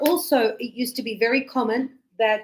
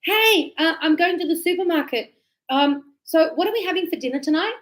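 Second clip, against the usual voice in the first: higher, bright phone call to daughter; cheerful mum on the phone; 6 semitones higher than usual. Shown as a demonstration. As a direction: sing it out like calling your child. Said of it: "just say".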